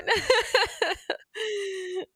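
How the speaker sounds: high, trailing peal of laughter; giggling laugh. Real, not acted. Host tickled by the rollerblading talk, laughing.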